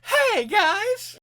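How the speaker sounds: very high, loud, chirpy greeting; over-bright comic falsetto call; mock cheery villain. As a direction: squeal a perky hello as a joke.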